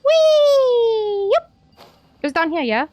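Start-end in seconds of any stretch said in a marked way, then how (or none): 0.0-1.3 high, loud, drawn-out whee; playful whee. Pretend. Player squealing along with the game, playful whee. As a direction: let out a long high whee.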